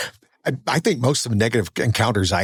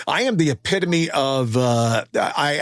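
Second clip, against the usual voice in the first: ordinary talk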